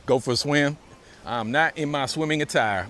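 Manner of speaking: ordinary talk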